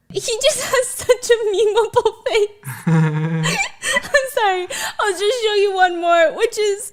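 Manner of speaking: high, teary laughing; wheezing through the words. Real, not acted. Laughing at memes.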